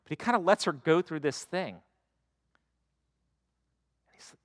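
normal talking voice

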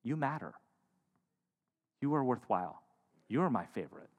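quiet, tender words of worth; tender affirmation. Retold or imitated. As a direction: say it gently, into someone's eyes. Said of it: "look into our eyes and say".

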